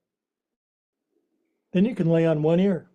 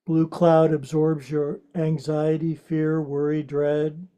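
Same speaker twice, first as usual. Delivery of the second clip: guided meditation; 2 semitones lower than usual. Acted. Guides restorative rest.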